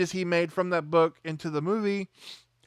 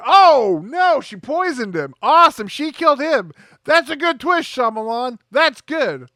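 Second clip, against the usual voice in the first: loud, high burst of delight; hammed-up thrill at a plot twist; mock excitement; 7 semitones higher, 6 dB louder than usual. Acting out his excited reaction to twist.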